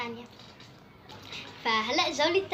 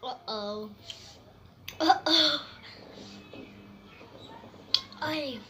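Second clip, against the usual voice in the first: pained "ooh" at sour taste; sour face; 3 semitones higher, much slower than usual. Real, not acted. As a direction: wince at the sourness. Reacting to very sour candy.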